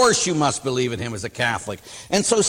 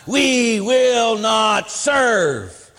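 very slow, higher, drawn-out chant; mock defiant proclamation; 7 semitones higher, 4 dB louder, much slower than usual. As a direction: ham up the ranting speaker. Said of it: "she pounded the podium".

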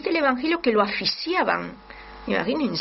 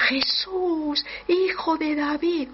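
pleading cry; 7 semitones higher, slower than usual. Retold or imitated. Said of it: "él gritaba cada vez más".

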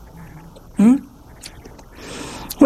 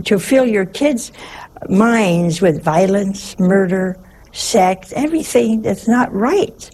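ordinary delivery

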